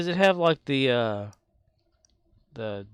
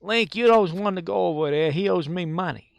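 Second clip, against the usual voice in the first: loud, high Bronx tough-guy fairy; Navi from the Bronx; 7 semitones higher, 5 dB louder than usual. Acted. Streamer plays Navi from the Bronx, loud high Bronx heckling. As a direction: bark it like a Bronx street fairy. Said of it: "Navi from the Bronx".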